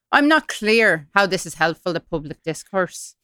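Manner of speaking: put-on Irish accent, reading an email; formal complaint in mock Irish. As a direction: read it out with an Irish lilt. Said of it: "doing this in an Irish accent".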